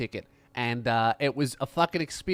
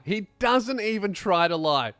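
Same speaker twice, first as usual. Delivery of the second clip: ordinary talk, nothing marked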